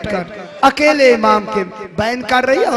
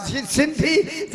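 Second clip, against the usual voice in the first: faint weak call; 4 semitones higher, 6 dB quieter, much slower than usual. Acted. Dying imam calls softly.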